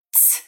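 voiceless hissing "Tss" like a cymbal; ts sound. Shown as a demonstration. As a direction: hiss "Tss" with tongue on teeth, no voice. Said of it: "como se no lugar desse Z a gente tivesse um T S".

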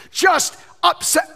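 high, loud, slowed outburst; shouted upset. Acted. Pastor acting out a husband's anger for the crowd, loud shout. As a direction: blurt it out like a fed-up husband. Preacher voicing an angry husband.

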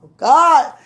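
high loud burst of laughter; excited shout. Real, not acted. Overcome with laughter.

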